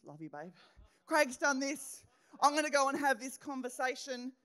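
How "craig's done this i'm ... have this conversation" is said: louder, higher mock outrage, "Craig's" hit hard; wife acting out her own huffy reaction, loud and indignant. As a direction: ham up the wronged wife ready to confront.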